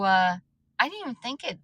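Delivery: normal talking voice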